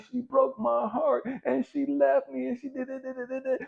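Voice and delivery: high mocking whiny complaint; mock whining. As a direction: parody a heartbroken whiner, sing-song.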